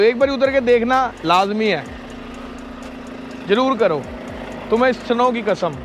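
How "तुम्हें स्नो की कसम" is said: ordinary talk, nothing marked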